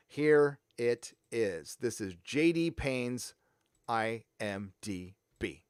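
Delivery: very slow, spaced-out words; mock grand reveal. Vlogger unveiling a showrunner's credits to viewers, slow mock drumroll delivery. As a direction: draw out each word for the big reveal.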